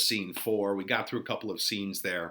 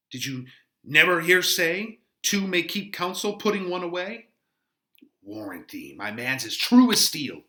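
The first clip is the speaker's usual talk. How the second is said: put-on loud, higher, gossiping old nurse; chattering nurse; 5 semitones higher, 5 dB louder than usual. Teacher voicing the nurse boasting about Juliet. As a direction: prattle on like a fond old nurse.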